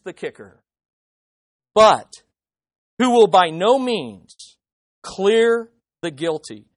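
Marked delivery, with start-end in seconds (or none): 1.7-6.7 loud and slow, each word weighed; slow emphatic warning. Acted. Preacher hammering the kicker to the congregation, slow loud stress. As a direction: lean hard on the warning.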